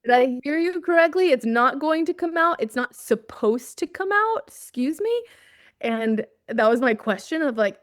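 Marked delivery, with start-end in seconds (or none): 0.0-2.6 loud, much higher, affronted questioning; mock outrage, demanding he repeat it; on request, replaying her irritated reply to God, loud and high; indignant disbelief. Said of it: "One more time". 2.6-4.4 It's not supposed to come out? thrown back higher, rising in offended disbelief; echoing God's words in mock outrage, higher and rising; incredulous echo. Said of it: "One more time". 4.5-5.2 mock-affronted challenge, voice shooting up; indignant excuse me. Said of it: "One more time".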